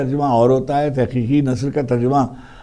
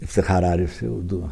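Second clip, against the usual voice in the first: lower, formal radio announcer read; borrowed newsreader tone; 6 semitones lower, 4 dB quieter than usual. Retold or imitated. At the radio audition, copying the old Hindi newsreader's lower formal announcing. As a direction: announce yourself like a stately bulletin opener. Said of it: "اب میں نے وہی tone جو تھی".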